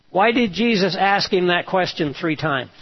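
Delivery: usual speaking voice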